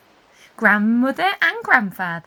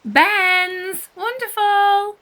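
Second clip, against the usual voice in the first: high loud calling of names; shout; 9 semitones higher, 6 dB louder, much slower than usual. A narrator's reading. Anna shouting for her cousins playing football, loud call. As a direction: call them over the noise of the beach. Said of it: "shouts Anna Hibiscus".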